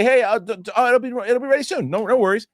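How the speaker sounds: high, light pretend reply; cheery imagined employee; what Jerry should have said, high and light; mock friendly worker. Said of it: "It's like".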